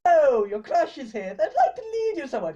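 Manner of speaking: sing-song mockery through a laugh, going high; teasing voice. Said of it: "and they're being".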